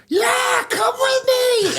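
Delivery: very high, drawn-out yell; hammed-up squealing invitation, stretched long; over-eager shout. Stranger eagerly leading him to the bar, imitated high shout.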